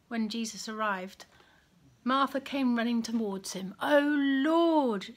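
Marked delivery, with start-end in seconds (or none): 3.8-5.1 mild, acted grief; a louder, anguished cry without real tears; sobbed lament. A narrator's reading. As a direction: lament with a touch of a sob. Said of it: "she sobbed".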